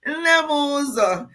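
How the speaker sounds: affectionate calling out to a viewer; calling out a name. Real, not acted. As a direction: call her name three times, stretching it. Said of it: "How many times did I call you?".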